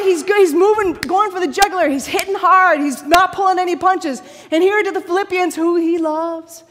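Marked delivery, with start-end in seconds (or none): 0.0-4.2 high, loud, punchy delivery; forceful preaching. Real, not acted. Preacher telling how hard Paul hits, loud and emphatic. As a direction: hammer each phrase home. Preacher hammering the point home.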